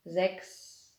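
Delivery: ordinary talk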